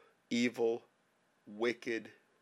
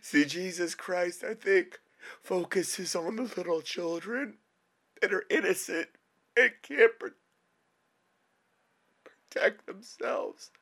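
crying. Real, not acted. Sobbing prayer about dying children.

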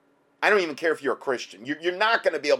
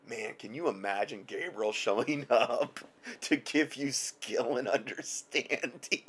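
awed quiet laugh; 6 dB quieter than usual. Real, not acted.

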